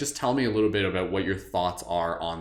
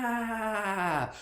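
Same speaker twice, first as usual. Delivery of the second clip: high, soaring whoop; joyful cry; 13 semitones higher, 4 dB quieter than usual. Retold or imitated. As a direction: cheer like riding a dragon. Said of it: "we were like".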